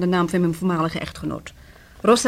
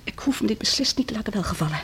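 softer, hissed indignation; indignant whisper; 7 dB quieter than usual. Acted. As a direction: breathe out your offence. Offended lady refusing insult.

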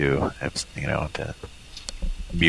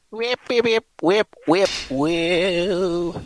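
video game sound; 13 semitones higher, much slower than usual. Retold or imitated. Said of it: "what is that from".

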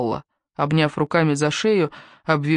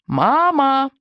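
put-on child calling mom; 9 semitones higher than usual. A narrator's reading. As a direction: call out like a little boy from the bath. Child calls out from bath.

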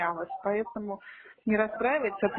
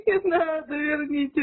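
crying on the phone; 4 semitones higher, slower than usual. Pretend. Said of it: "я плачу".

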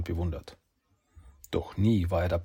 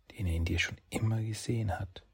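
softer and softer whisper; 9 dB quieter than usual. A narrator's reading. Kitsune whispers Saki's destiny to him. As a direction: fade into a whisper. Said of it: "flüstert ihm zu".

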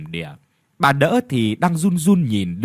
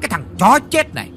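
higher, louder, fast furious tirade; raging old master calling his servants; loud angry shout; 4 semitones higher, faster than usual. Acted. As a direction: scream curses at the beggar. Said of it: "Lão đã lớn tiếng".

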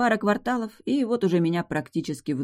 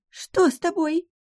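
high-pitched, worried bride; alarmed cry. A narrator's reading. Bride asking her ailing groom, crying out. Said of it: "вскрикнула невеста".

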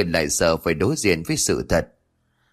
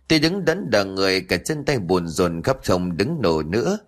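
usual speaking voice

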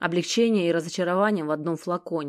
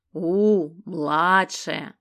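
disappointed drawl; 4 dB louder, much slower than usual. A narrator's reading. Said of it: "разочарованно тянет Геныч".

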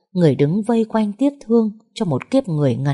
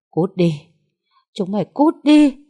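a weak voice that breaks high and louder on the second phrase; sobbing rasp; 4 semitones higher, 4 dB louder than usual. A narrator's reading. Grieving woman sobbing at visitors to get out. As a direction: push them away through sobs. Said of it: "Tiếng nó thều thào, giọng còn nức nở".

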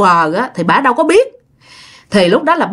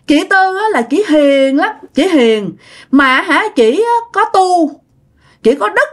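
put-on high little-child voice; toddler voice; 7 semitones higher, slower than usual. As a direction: speak slowly and sweetly like a small kid. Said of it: "giống như đứa con nít".

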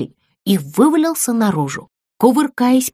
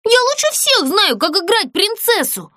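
stamping insistence; 11 semitones higher than usual. Acted. Doll stamping foot, insisting.

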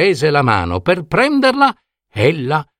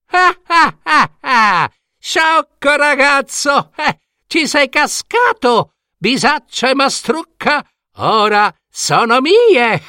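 high, shrill-pitched witch cackle and taunt; mocking cry. Wicked woman jeering at the tricked boy with a high cackling cry. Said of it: "deridendo Franceschino, gridò".

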